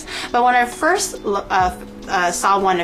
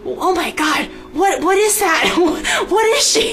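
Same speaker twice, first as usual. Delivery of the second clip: shocked disbelief; 6 semitones higher than usual. Retold or imitated. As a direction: blurt it out in shock. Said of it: "I was just like".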